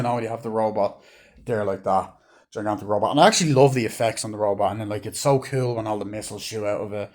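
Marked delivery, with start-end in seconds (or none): none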